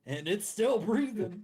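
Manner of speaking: high, loud, drawn-out holler; stretched-out shouted report; acted shout back. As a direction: call it out slowly and loudly. Acted shout answering the warning yell.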